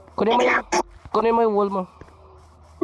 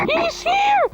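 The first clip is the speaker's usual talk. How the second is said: much higher, urgent alarm; alarmed cry. Real, not acted. Player warns his friend the enemy has arrived. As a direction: warn in a panic.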